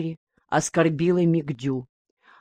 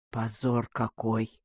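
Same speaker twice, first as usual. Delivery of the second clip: very low, hushed and slow; whispering old woman; 7 semitones lower, 11 dB quieter, slower than usual. A narrator's reading. Old woman at the shocking confession, whispering. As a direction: murmur it slowly under your breath. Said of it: "прошептала какая-то старуха".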